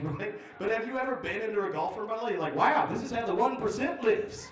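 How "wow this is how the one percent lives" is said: high, loud wonder with a laugh; mock awe. Comic under a huge golf umbrella, mock awe.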